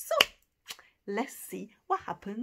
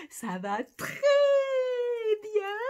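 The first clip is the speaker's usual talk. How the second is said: ça va très... bien! with a jump up on bien; delighted gasp; 12 semitones higher, 9 dB louder than usual. Shown as a demonstration. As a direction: gasp then bien said high and bright. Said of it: "For I am feeling very well".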